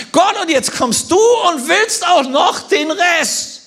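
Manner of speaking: high, drawn-out outcry; indignant protest. Acted. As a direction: hurl a slow, pleading accusation upward. Acts out helpless woman's protest to God.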